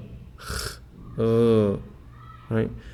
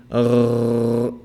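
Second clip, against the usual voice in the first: a long trilled rrrr, slightly louder; rolled r; 4 dB louder than usual. Shown as a demonstration. Teacher demonstrating the wrong rolled sound, long trill. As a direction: roll the r on purpose as the wrong way. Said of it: "And it's not rolled".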